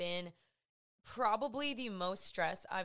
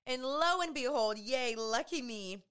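sarcastic; 4 semitones higher, 4 dB louder than usual. Real, not acted. Sarcastic about floor breaking again.

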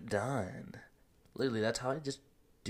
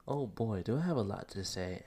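ordinary talk, nothing marked

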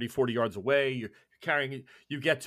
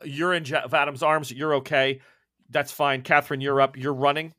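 ordinary talk

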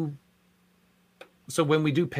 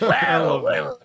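high-pitched, louder cartoon sailor croak; Popeye voice; 6 semitones higher, 5 dB louder than usual. Shown as a demonstration. Cast member shows fans the voice used for the cop, high Popeye voice. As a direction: do your high Popeye catchword, laughing. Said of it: "It's my high pitch, uh, Popeye voice".

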